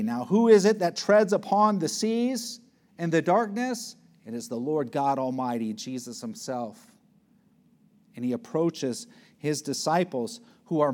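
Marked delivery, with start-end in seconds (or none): none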